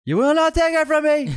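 high shouted movie-villain cry; Anakin impression. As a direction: yell it like a fallen Jedi. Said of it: "This is a pretty good impression".

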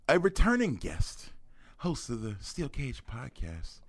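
ringmaster. Acted. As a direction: build the crowd up like a ring announcer. Announcing guest entrance.

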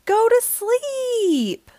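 high, slow, drawn-out bedtime order; exasperated mom at bedtime; grumpy mom. A narrator's reading. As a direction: stretch it out like a fed-up mother. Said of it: "also very grumpy at night".

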